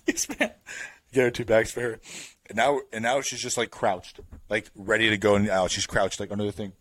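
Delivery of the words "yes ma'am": squeaky mock obedience. Obeying drunk woman mockingly.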